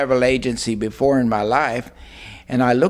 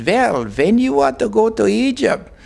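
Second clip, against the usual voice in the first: imitated Hungarian accent, much higher pitch; 9 semitones higher than usual. Travel agency lady asking a customer, imitated Hungarian accent. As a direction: quote the lady in a high, lilting Hungarian accent. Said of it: "she says to me in her accent".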